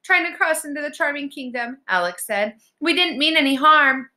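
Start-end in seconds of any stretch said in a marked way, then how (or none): 0.0-1.8 put-on high, anxious girl's voice; high-pitched, cowed explanation; timid answer. A narrator's reading. Scared Alex answers the troll. 2.8-4.1 put-on high, louder girlish plea; high and pleading; anxious pleading. A narrator's reading. Scared Alex pleads with troll.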